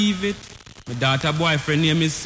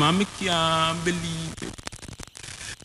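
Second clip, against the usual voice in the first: hushed disbelief; 6 dB quieter, much slower than usual. Acted. Refrain said low, then answered.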